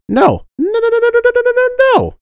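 high rapid string of noes; high fast repeated no; emphatic no. Real, not acted. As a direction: shut the idea down, high. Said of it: "emphatic rejection".

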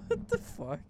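high squeaky laugh through the words. Real, not acted. Cohost squeals at a friend's comeback, laughing. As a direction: break into a laughing what-the.

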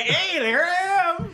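high, drawn-out mock Irish accent; Irish-Canadian accent. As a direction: let a silly Irish accent pop out, high. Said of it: "it always is peeking through me like".

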